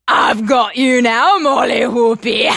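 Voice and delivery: slow, loud booming giant; giant's shout. Acted. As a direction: roar it out, triumphant. Giant catches Molly.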